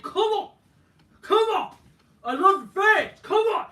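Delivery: shouted high and loud, hammed up; hammed-up fan shout. Orton imitated whipping up the fans with a hammed-up shout. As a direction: yell it out like a wrestler hyping the crowd.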